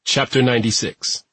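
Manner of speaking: plain reading voice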